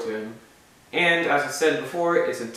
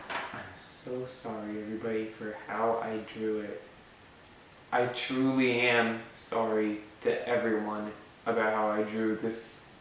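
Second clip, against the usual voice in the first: hushed apology; 4 semitones lower, 9 dB quieter than usual. Real, not acted. Creator apologising to everyone for his drawing, hushed and contrite. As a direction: drop right down and own up quietly.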